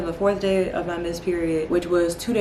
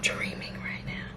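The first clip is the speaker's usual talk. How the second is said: hushed, breathless whisper of disbelief; stunned whisper; 11 dB quieter, slower than usual. Real, not acted. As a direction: whisper it as if you can't believe your eyes. Still in shock after the test.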